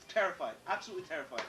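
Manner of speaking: usual speaking voice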